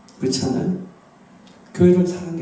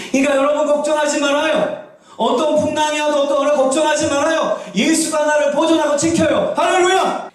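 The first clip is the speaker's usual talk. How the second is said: high, shouting assurance; fervent preaching; 10 semitones higher than usual. Acted. Pastor assuring the congregation, high and shouted. Pastor reassuring at full force.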